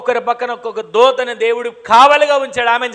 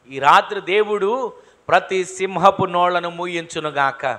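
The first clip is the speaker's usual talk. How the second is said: usual speaking voice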